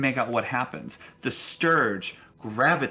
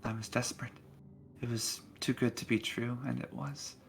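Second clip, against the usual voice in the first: put-on a weary old spirit, quietly grieving; old woman ghost; 5 dB quieter than usual. GM plays ghost telling her death.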